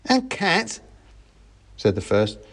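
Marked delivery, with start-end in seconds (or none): 0.1-0.8 high mouse voice jumping in surprise; dawning realisation. A narrator's reading. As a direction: realise it suddenly as the little soldier. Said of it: "finally realizing".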